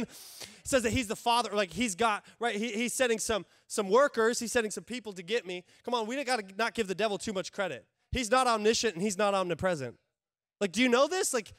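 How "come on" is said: ordinary delivery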